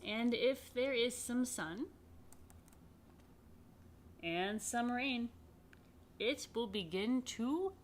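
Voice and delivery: animated picture book read; reading to children. Acted. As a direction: build wonder as the seed grows.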